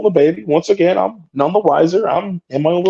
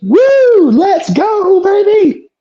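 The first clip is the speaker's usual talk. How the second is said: high, louder whoop; hype entrance; 5 dB louder, much slower than usual. Retold or imitated. Wrestler walking out with baby, high hype whoop. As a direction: hype the crowd up. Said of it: "sure as shit".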